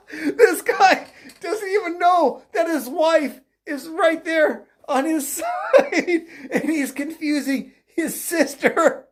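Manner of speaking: high, helpless laughter through the words; cracking up, drawing out words between laughs; laughing hard. Real, not acted. Commentator retelling the wife mix-up, laughing hard.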